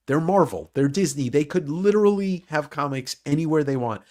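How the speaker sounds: normal talking voice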